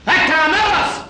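high, loud shout across the room; calling out. Acted. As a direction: bark the servant's name to summon him. Calling the servant loudly. (نداء عالٍ للخادم)

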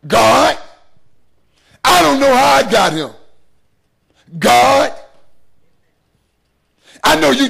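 a loud, crying plea to God; mocking a member's tearful prayer about her husband; mock wailing prayer. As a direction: cry out to God in hammed-up despair. Congregant's prayer voiced, crying out.

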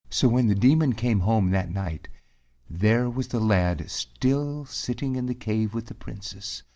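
ordinary delivery, nothing marked

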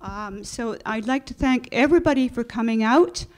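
loud. Real, not acted. Thanking panelists into microphone.

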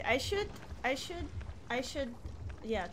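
usual speaking voice

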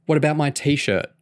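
a touch of playful indignation; hint of offended protest; indignant. A narrator's reading. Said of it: "Kerry said indignantly".

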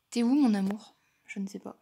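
usual speaking voice

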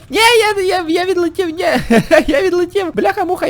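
high, alarmed cry as the bus flies off; a real jolt of panic; startled yelp. Real, not acted. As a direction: yelp it out in surprise.

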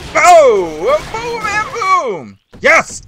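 high, loud victory yells; triumphant boom shouts; excited shouting. Real, not acted. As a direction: roar it out as you win. Winning the fight.

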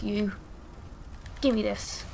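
ordinary delivery

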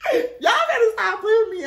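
high, loud, laughing squeal; squealing with laughter; laughing sassy outburst. Real, not acted. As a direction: laugh it out, thrilled.

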